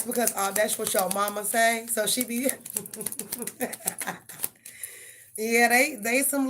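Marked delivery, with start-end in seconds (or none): 2.4-4.2 soft laugh. Real, not acted. Tickled at a child repeating mama, soft laughing. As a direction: chuckle quietly to yourself.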